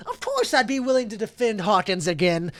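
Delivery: high-pitched cocky character voice; boastful defender. Acted. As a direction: declare it proudly, pitched well up. Boastful rival answers the challenge.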